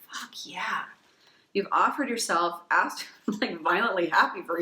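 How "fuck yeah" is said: hushed excited aside; breathy little whispered cheer. Real, not acted. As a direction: whisper it to yourself, thrilled. Excited private aside after reading.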